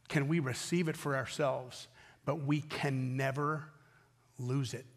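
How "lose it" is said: usual speaking voice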